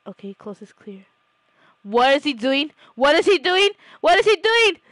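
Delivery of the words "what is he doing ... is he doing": rising, louder and higher each time; building amazement at the pet; disbelief. Real, not acted. Watching the pet do something odd, rising disbelief.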